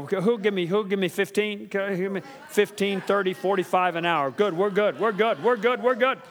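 fast, rising auctioneer chant. Pretend. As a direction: rattle off bids like an auctioneer. Bidding for minutes like auction.

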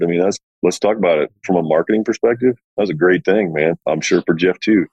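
usual speaking voice

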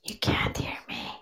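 breathy late-night whisper; quiet at night. Real, not acted. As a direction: keep it to a whisper. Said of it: "I'd like to talk like this".

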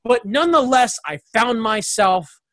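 normal talking voice

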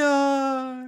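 high, sustained comic "no"; overly dramatic refusal. Retold or imitated. Imagining the heroine refusing in a comedy episode, overly dramatic. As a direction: stretch it out melodramatically. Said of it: "overly dramatic framing".

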